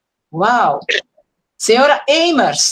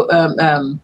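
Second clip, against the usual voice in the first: usual speaking voice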